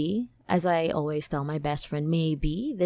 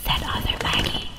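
whisper. Acted. Whispered intro tag.

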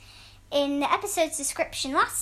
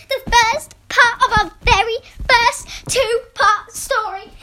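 higher, louder, thrilled; excited; 6 semitones higher, 8 dB louder than usual. Real, not acted. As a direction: announce it with real excitement. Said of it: "how excited I am".

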